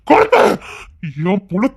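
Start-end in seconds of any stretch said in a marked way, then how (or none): 0.1-0.4 sharp, very high and loud yell; furious yell. Acted. An enraged man yelling at her to burn the dress. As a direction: scream the order. Angry man orders burning.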